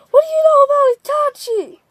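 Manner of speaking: high, fast, pushy demand in a put-on voice; sharp demand. Sasuke demanding answers about his brother, high and fast.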